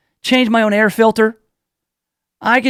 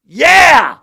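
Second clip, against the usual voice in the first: triumphant shout; 6 semitones higher, 7 dB louder than usual. Real, not acted. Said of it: "boasting cheer".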